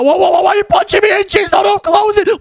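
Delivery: high, alarmed yells of protest; squeaky frightened shouting, no no no; panicked yelling. Acted. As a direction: yell in mock panic as you're shoved in. Being shoved into the prison.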